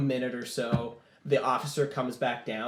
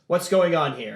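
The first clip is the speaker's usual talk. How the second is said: louder, lightly voiced officer's demand; commanding general; 3 semitones higher, 7 dB louder than usual. General confronting the crowd, mildly commanding. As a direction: demand it with mild authority.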